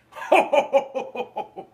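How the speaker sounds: high loud ho ho ho; jolly belly laugh. Acted. Santa laughs loudly like a bowl full of jelly. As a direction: let a big Santa laugh roll out. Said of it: "shook when he laughed like a bowl full of jelly".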